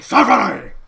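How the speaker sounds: loud bellowing call for his daughter; Vince yelling. Pretend. Vince calling his daughter, loud bellow. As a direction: bark her name like an angry boss.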